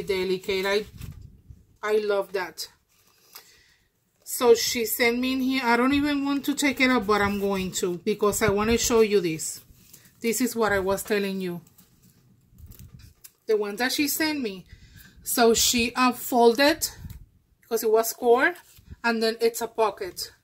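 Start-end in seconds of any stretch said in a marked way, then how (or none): none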